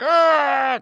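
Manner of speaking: high-pitched imitated yell; mock fight yell. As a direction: let out a high mock scream. Acting boy in a big fight.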